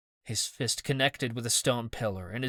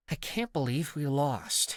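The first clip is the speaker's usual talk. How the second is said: grumbling; 4 semitones higher than usual. A narrator's reading. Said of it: "he grumbled".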